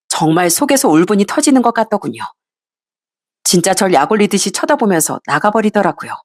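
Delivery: plain reading voice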